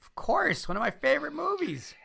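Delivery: high gushing enthusiasm; excited. Real, not acted. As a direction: gush like a superfan. Over-eager emphatic answer.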